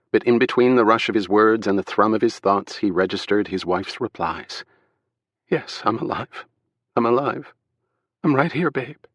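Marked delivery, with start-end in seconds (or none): 5.5-9.0 higher, shaky relieved wife; tearful wife voice. A narrator's reading. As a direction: speak through overwhelming relief. Wife alive on phone.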